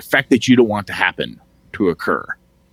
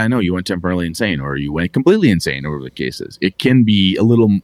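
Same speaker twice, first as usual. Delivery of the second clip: ordinary delivery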